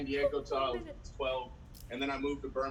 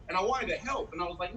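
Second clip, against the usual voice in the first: ordinary delivery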